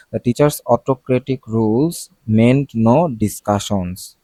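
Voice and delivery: very slow, careful English read; slow example read. Shown as a demonstration. Teacher reading an example sentence to students, very slow. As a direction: spell out the sentence slowly for students.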